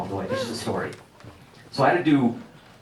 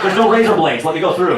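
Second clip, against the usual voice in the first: higher and louder, panicked; frantic; 8 semitones higher, 12 dB louder than usual. Retold or imitated. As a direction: rush it out in a panic. Frantic rush at security.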